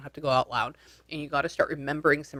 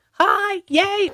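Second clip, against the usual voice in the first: much higher, louder happy squeal; delighted greeting; 8 dB louder than usual. Real, not acted. Caller finally back on the line, excited high greeting. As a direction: greet them like a friend back from far away. Caller reconnects after dropping.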